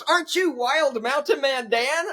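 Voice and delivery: high, slow delighted question; starstruck fan. Retold or imitated. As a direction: gush like a fan spotting a celebrity. Fan recognizing him.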